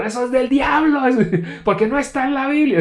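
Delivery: high, loud yelling; imitating his yell about the devil; retold shout. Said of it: "a grito".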